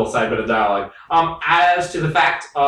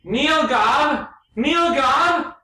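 high, slow excited yell; excited shout; 12 semitones higher, much slower than usual. Acted. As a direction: exclaim it way up high. Mocking excited outburst.

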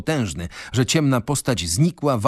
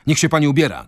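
loud, raised-pitch command; firm shout, pitched up; shouted order; 5 semitones higher, 5 dB louder, much faster than usual. A narrator's reading. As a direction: call out an urgent order. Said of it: "krzyknął Wroński".

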